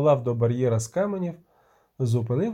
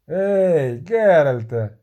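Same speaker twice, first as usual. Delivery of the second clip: loud hail to someone; called-out shout; 6 dB louder, much slower than usual. A narrator's reading. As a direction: shout the name to get attention. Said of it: "крикнув Бугольд". (гучний оклик)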